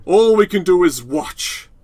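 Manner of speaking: slow, loud and solemn; hammed-up dramatic delivery; dramatic quote. Quoting a dramatic line.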